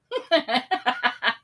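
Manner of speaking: put-on cheeky boy's laugh. A narrator's reading. Sam laughing at the angry villagers. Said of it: "Just laughed".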